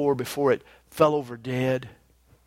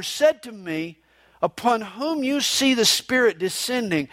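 higher, formal reading of scripture; 6 semitones higher than usual. Acted. Preacher reading the Gospel aloud to the congregation. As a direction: proclaim the verses like a lector.

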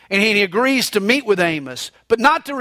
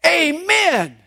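sudden shout; 7 semitones higher than usual. Retold or imitated. Rider remembering the stop command at the edge, sudden shout. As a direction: blurt it out as it hits you. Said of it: "suddenly it hits him".

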